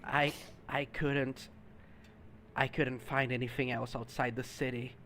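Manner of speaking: meek little creature pleading softly; timid creature voice. Acted. Creature answers vendor quietly.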